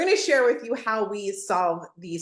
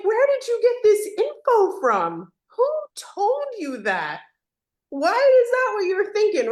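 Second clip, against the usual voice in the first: mock puzzled leader; 10 semitones higher, 3 dB louder than usual.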